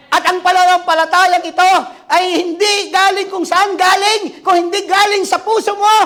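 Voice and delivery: high, loud preacher's shout; fiery preaching. Acted. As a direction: drive the faith point home. Preaching at full force. (nag-aalab na pangangaral)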